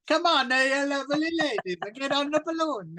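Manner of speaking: put-on flirty over-the-top Italian accent. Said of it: "outrageous Italian accent".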